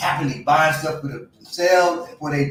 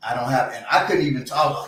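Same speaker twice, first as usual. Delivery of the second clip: ordinary talk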